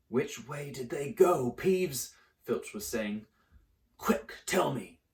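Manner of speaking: slow, pressing demand; gruff caretaker. Acted. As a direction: hunt the students down. Filch demanding Peeves.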